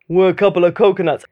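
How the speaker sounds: quick, lightly put-on Rocky voice; a knowingly bad Stallone, tossed off fast; Sylvester Stallone impression. Mum retelling Rocky to her baby, a quick bad Stallone voice. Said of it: "just doing this terrible impression".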